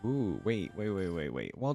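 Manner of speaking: normal talking voice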